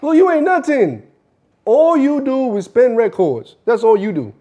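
loud, high, belittling tirade; angry berating. Acted. Boss belittling a DJ who complains about pay, loud and angry. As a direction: cut him down loudly.

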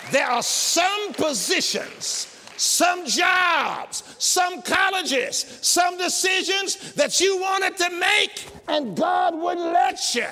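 high, loud preaching that builds up; full-force preaching. Acted.

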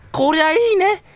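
high, loud savoring glee; real gleeful savoring; delighted. Real, not acted. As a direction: relish it out loud. Delight at kill.

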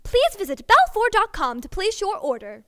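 ordinary talk, nothing marked

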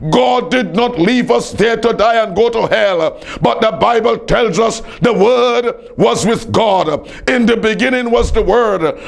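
ordinary talk

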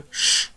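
"sz" as a long sustained hushing consonant; drawn-out sound demo. Shown as a demonstration. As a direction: hold out "sz" to show the sound. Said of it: "S przechodzi tutaj".

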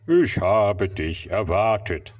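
slow, deep wise octopus. Acted. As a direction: speak slowly in a deep voice. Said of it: "sagte Oktopus mit tiefer Stimme".

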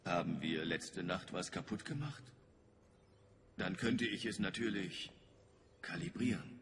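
normal talking voice

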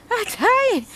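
high-pitched, worn-out whine; tired whine. Real, not acted.

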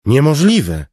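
loud, high outburst; exclaimed. A narrator's reading. King refusing to give up his guards, loud and high. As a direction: cut him off with a flat refusal. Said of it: "wykrzyknął Elokar".

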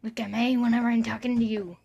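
loud, fast telling-off; loud quick scold aimed at the cat. Real, not acted. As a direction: snap at the pet to pay attention.

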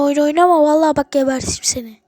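furious shouted threat. Real, not acted. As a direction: yell it like you mean to kill. Threatening the game baby.

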